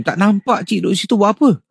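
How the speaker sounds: mildly jolted, pitch up as you ask; startled question. Acted. Nephew finding his aunt sitting in the dark kitchen, startled.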